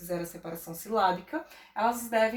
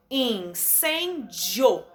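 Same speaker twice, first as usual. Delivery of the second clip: incêndio drawn out slowly as in-cên-dio; slow syllable by syllable; much slower than usual. Shown as a demonstration. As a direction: beat out incêndio in three slow pieces. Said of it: "Três". (incêndio bem devagar, sílaba por sílaba)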